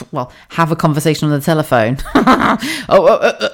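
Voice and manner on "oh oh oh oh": laughing. Real, not acted. Laughing about husband juggling.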